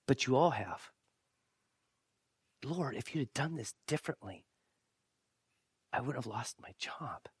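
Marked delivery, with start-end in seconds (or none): 2.6-7.3 hushed, bitter prayer to God; acted hurt complaint. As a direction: quietly reproach God, voice sinking. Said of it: "ever been mad at God before".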